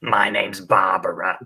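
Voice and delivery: slow, put-on old lady character; Barbara voice. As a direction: lean into each syllable as Barbara. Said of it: "a character that I developed".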